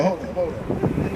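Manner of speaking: normal talking voice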